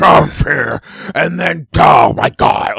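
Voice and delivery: loud, high outburst of frustration; exasperated shout. Real, not acted. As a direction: yell it out, fed up. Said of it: "I just don't understand".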